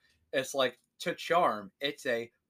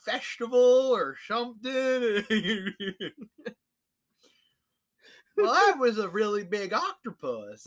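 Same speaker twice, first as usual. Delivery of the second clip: much higher, louder dopey voice; dopey character; 12 semitones higher, 6 dB louder than usual. Retold or imitated. Player voices the oblivious investigator, high and dopey. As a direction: keep playing the clueless investigator.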